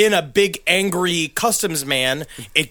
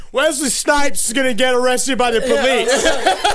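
higher, louder blurt with a laugh; mock loud blurt; 6 semitones higher than usual. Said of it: "but I was going".

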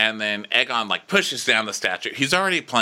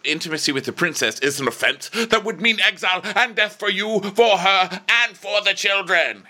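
higher, fast fretting lecture; mock lecturing lord; 10 semitones higher than usual. Lionel warning his son in a fast, higher fretful voice. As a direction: rattle off the warning.

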